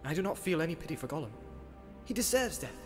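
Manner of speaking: soft, high, hurried protest; faint, agitated voice from memory; far-off remembered voice. Acted. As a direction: object heatedly but at a distance. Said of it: "quite plainly but far off".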